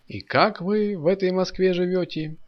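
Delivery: high-pitched wondering question; astonished. A narrator's reading. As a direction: marvel at how they manage. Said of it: "удивлялся Молчун".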